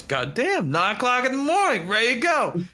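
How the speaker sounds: suddenly raring to go; Shaggy voice. Retold or imitated. Shaggy hearing Velma needs help, high and eager. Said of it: "And he just goes".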